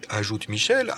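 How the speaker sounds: ordinary delivery, nothing marked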